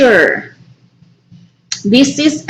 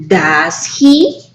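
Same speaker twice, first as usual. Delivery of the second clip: "Does he" ending on an upward questioning rise; rising intonation; 4 semitones lower than usual. Shown as a demonstration. Teacher showing an unsure tag question, rising intonation. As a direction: lift "he" at the end, unsure of the answer. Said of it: "the intonation goes up in that case".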